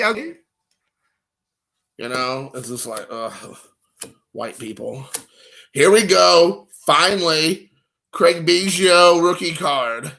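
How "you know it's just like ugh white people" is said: much softer, lower muttered aside. Real, not acted. Collector mumbling about an awkward kid photo, under his breath. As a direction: mumble it under your breath, half to yourself.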